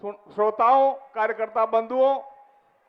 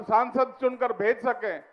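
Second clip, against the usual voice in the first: ordinary talk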